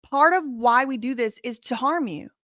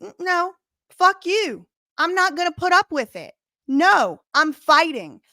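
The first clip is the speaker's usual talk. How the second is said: outraged defiance; 6 semitones higher, 3 dB louder than usual. Real, not acted. Outraged refusal to back down.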